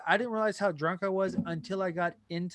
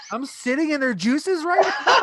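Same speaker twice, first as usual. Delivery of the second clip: higher, louder, laughing in disbelief; laughing disgust; 8 semitones higher, 5 dB louder than usual. Retold or imitated. Passenger to taxi driver on hearing the story, laughing grossed-out disbelief. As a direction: recoil with a laugh.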